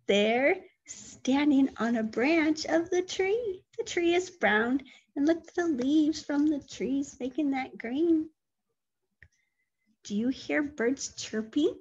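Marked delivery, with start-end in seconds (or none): none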